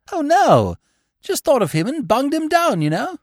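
put-on high, airy young gentleman; blithe young Bertie. A narrator's reading. Bertie arguing for his friend's love, blithe and earnest. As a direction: plead the case lightly and high.

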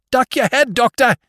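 high, loud warning; warning shout. Acted. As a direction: yell to the doctor to get down. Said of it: "I heard the captain shout".

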